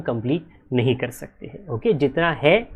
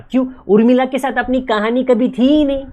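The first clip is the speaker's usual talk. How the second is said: emphatic; 9 semitones higher, 5 dB louder than usual. Shown as a demonstration. Teacher emphatic explanation.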